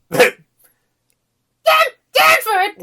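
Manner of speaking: very high, loud strained cry; high gravelly attempted shout; bad impression. Shown as a demonstration. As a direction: try a cartoon voice and fail. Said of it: "I don't think I can do this".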